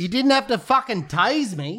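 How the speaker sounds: high-pitched aggrieved outcry; protesting arrested man. Pretend. Pretend prisoner protesting to fake cop, high outcry. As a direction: complain like a man just tased. Acts tased prisoner in background.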